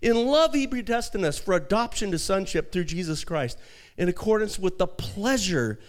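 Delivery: high, loud, impassioned sermon; full-force preaching. Acted. Pastor urging the congregation, full-force preaching. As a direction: preach it out to the crowd.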